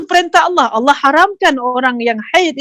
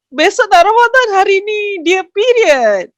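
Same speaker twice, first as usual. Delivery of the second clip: higher, drawn-out upset wail; mock tearful complaint; 6 semitones higher than usual. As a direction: imitate a woman upset over bad timing. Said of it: "Dia menangis sebab dia kata". (rungutan sedih yang dibuat-buat)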